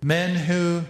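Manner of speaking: ordinary talk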